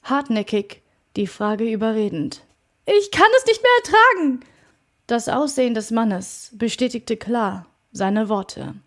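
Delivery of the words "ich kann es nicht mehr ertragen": high, loud, fast outburst of fear; desperate cry. A narrator's reading. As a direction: break out in panic, much higher and louder. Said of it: "bin ich in ständiger Angst".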